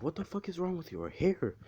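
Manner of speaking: high, stunned what the fuck; shocked parents. Retold or imitated. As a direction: pitch way up in disbelief.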